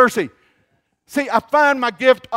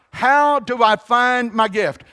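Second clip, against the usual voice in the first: ordinary talk, nothing marked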